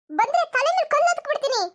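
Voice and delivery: high-pitched acted outburst; angry threat. Mother-in-law to daughter-in-law wanting sleep, angry threat. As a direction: threaten her in a high, cross voice.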